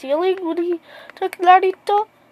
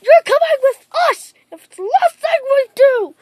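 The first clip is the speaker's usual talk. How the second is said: raised, exasperated acted threat; fed up; 7 semitones higher than usual. Pursuers warning Naruto after another failed attempt, fed up. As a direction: pitch it high, an acted last-straw ultimatum. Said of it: "fed up at Naruto".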